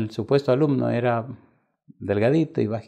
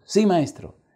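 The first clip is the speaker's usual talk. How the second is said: frightened answer; 9 semitones higher, 5 dB louder, slower than usual. Retold or imitated. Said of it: "el tipo se asustó".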